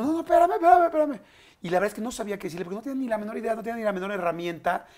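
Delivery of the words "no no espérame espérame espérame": high, loud panicked pleading; begging him to wait, high and loud; urgent plea. Retold or imitated. Stopping a friend who wants to die, a high loud plea.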